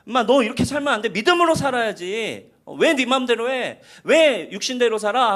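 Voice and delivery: God scolding Abraham in a fast, loud rebuke; angry scolding. Retold or imitated. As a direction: rattle off the rebuke like an exasperated parent. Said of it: "약간 이런 느낌으로 보여요".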